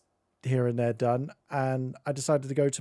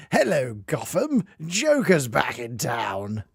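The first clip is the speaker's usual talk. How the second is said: slow, drawn-out Hamill Joker; Mark Hamill Joker; slower than usual. Retold or imitated. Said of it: "kind of Mark Hamill sort of voice".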